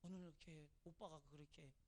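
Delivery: hushed, low funeral sympathy; mock condolence. Visitor consoling a bereaved sister, hushed and solemn. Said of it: "이렇게 위로한답시고 위로하는데".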